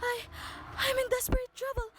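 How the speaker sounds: barely audible, terrified plea; frightened whisper. Acted. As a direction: whisper into the phone so no one downstairs hears. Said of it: "I cannot hear you, madam".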